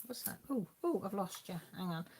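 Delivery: normal talking voice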